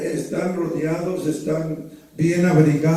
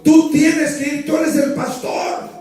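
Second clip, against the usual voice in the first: raised, higher voice pushing her to go; retold insistence; 9 semitones higher, 4 dB louder than usual. Husband telling wife she must go, raised and insistent. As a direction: insist the way you told her back then.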